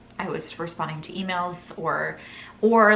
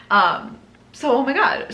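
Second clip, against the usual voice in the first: excited; 5 semitones higher, 6 dB louder than usual. Real, not acted. Excited about getting a client.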